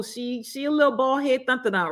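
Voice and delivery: high, teasing sass about the plant; playful diss of a bare plant; sassy joke. Real, not acted. Joking about a bald plant.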